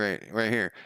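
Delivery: usual speaking voice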